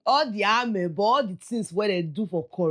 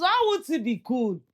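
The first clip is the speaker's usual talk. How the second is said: mock disbelief; 8 semitones higher than usual. Mocking incredulous repeat.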